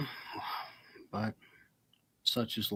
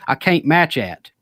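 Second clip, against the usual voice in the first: slow, loud Kentucky drawl; Kentucky accent; 5 semitones higher, 6 dB louder, slower than usual. Pretend. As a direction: drag it out like a Kentucky good ol' boy. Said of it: "Hicks is from Kentucky".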